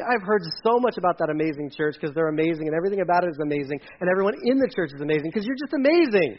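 fast, run-on mock praise; imitated gushing fan. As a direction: gush without stopping for breath. Gushing fan of church, voiced.

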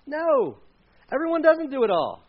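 firm answer to the questions; emphatic no. Real, not acted. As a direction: punch the answer home. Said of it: "emphatic answer".

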